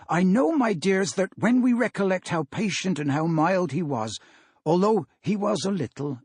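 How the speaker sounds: put-on a father gently rallying his children; Bob Cratchit voice. Bob speaks of Tiny Tim.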